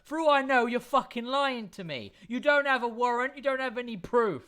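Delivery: loud, high angry guard yelling; defensive guard shouting. Acted. Guard yelling at the party to go back. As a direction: bark it out, defensive and furious. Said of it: "and this one goes".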